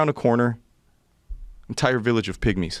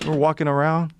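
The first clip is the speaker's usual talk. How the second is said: ordinary talk, nothing marked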